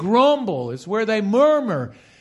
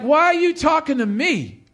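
high-pitched, indignant mock challenge; a little attitude; 6 semitones higher than usual. Preacher voicing the woman at the well, with attitude. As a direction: act out her sassy surprise at being addressed. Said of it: "she probably said it with a little bit of attitude".

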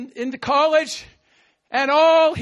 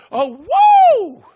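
high, loud burst of joy; a joyful shout of surprise; excited whoop; 9 semitones higher, 11 dB louder than usual. Acted. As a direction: whoop like you just leapt. Acting out jumping for joy.

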